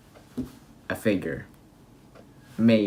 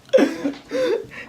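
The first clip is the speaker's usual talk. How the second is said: high-pitched burst of real laughter. Real, not acted. Laughing at the Lizard Man cheese impression, high-pitched real laughter.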